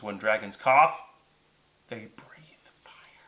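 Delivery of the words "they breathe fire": slow, drawn-out whisper; very soft, unvoiced and slow suspense; hushed reveal. A narrator's reading. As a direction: whisper it like an ominous secret.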